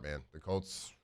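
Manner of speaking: usual speaking voice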